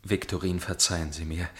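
ordinary talk

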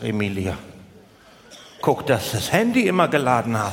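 dry advice. Retold or imitated. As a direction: say it low and deadpan like sage advice. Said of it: "ich sag".

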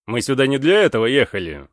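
loud, defiant answer; firm loud reply. A narrator's reading. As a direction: answer for the whole squad firmly. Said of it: "ответил за всех капитан".

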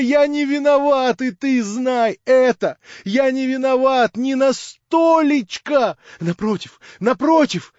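high, loud excited shouting; crying out to the room in rapture. A narrator's reading. Young man shouting to the narrator, overjoyed. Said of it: "кричал он".

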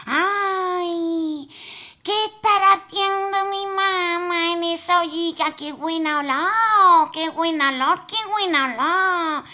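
put-on high, tiny voice of a greedy little boy. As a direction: sneak a peek like a naughty child. Child peeks into pot.

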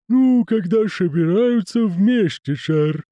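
slow, high creaky lisping servant; creaking groaning door voice. Acted. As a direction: drawl it like a creaky manservant.

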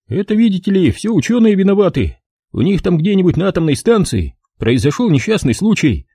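a wiseacre spinning his theory; cocky know-it-all soldier. A narrator's reading. Evans voiced by narrator.

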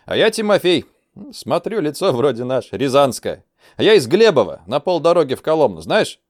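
pleased. Acted. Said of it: "обрадовался инструктор".

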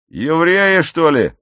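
patrolman's loud shout; called-out question. Acted. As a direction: call it out loudly like a patrolman. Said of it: "крикнул один патрульный".

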